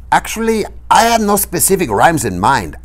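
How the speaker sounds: high, sing-song admission; sheepish Inigo. A narrator's reading. Inigo confesses his trick to Fezzik.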